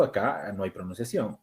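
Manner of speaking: ordinary talk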